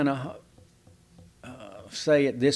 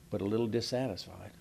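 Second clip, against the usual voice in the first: mock quiet admission; 4 semitones lower, 10 dB quieter, faster than usual. Acted reply in small talk.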